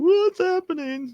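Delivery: very high, slow, squeaky wail; crying on the couch, a hammed-up high wail; mock bewildered crying. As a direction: whimper it like a confused sobbing slob.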